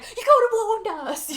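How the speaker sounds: high, loud mock scream; exaggerated retold shriek; imitated screaming. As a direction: yell it like a hammed-up panicked accusation. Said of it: "Matt screaming his head off".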